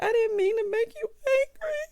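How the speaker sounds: mock crying plea. Imitating Landi crying to her cousin, mock tearful. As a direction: squeak it out like a mock sob. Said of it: "Landi starts crying".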